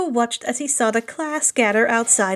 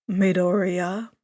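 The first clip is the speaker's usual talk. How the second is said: lower, slower teacher voice; deep voice; 6 semitones lower, slower than usual. A narrator's reading. Teacher calling the nervous student, low and slow. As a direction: call his name low and slow. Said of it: "a deep voice startling him".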